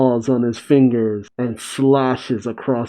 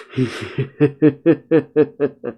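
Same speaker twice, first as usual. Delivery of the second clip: short, mocking villain chuckle; a creepy laugh, like he's already won. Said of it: "And he even laughs".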